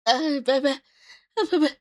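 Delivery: hoarse after coughing; coughing fit. Real, not acted. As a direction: speak between coughs, spent. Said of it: "shouldn't be coughing on everyone's ears".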